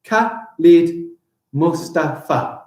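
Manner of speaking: slow "Khalid Mustafa" with a gap between beats; deliberate, separated syllables. Shown as a demonstration. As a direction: count out "Khalid Mustafa" in five. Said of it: "That is five".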